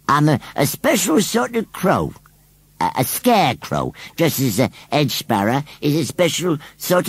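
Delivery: high rustic explaining voice; argumentative rustic scarecrow. A narrator's reading. Scarecrow argues that he is a bird, insistent. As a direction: argue odd logic as a scarecrow.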